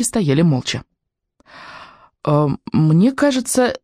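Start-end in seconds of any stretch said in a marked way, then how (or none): 2.2-3.8 unsure. A narrator's reading. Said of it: "неуверенно произнесла Софья Михайловна".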